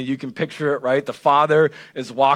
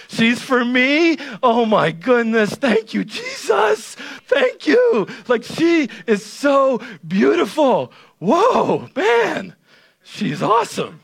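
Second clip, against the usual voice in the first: ecstatic Adam; 9 semitones higher, slower than usual. Retold or imitated. Adam seeing his bride for the first time, ecstatic mock shout. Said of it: "He says".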